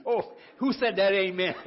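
loud, high delighted burst; laughing outburst. Real, not acted. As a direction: cry out amused at yourself. Congregation laughs at confession.

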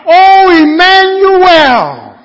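full-voiced, high, drawn-out cry. Preacher voicing troubled people calling on God, crying out. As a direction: shout it long and slow to God. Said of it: "until they cry out".

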